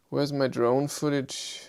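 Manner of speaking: usual speaking voice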